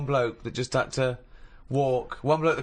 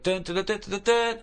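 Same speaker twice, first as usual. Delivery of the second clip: high sung trumpet notes; trumpet imitation; 8 semitones higher than usual. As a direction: hum a battle trumpet.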